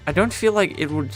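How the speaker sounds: ordinary talk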